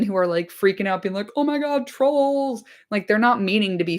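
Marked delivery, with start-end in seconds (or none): 1.4-2.6 high mock panic about the trolls; panicked hobbits. Hobbits Merry and Pippin freaking out about trolls, high mock panic. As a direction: squeal it like a scared hobbit.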